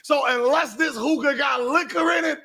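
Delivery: mock him through laughter; laughing mockery. Real, not acted. Mocking the stats, laughing.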